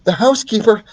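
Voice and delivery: corrected to the mother's voice; mother mouse voice. Acted. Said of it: "wrong voice".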